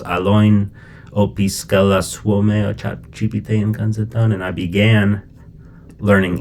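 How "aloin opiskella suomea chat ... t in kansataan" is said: reads the Finnish line aloud carefully; Finnish sentence. Shown as a demonstration. Teacher reading the Finnish example to students, careful Finnish. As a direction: model the Finnish sentence for learners. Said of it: "Finnish was".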